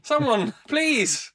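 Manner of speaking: high, loud mock plea; weak trapped cry for help. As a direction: cry out for rescue.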